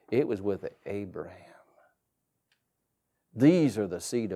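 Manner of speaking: low, soft and deliberate argument; a hushed low plea; Moses arguing softly. Retold or imitated. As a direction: drop way down and reason it out. Moses argues with God.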